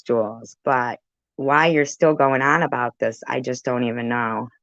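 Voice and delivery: ordinary delivery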